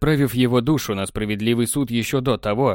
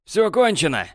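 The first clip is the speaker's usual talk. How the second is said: final declaration; 7 semitones higher than usual. A narrator's reading. Borland ending it with Technar, high decisive. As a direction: declare it, done.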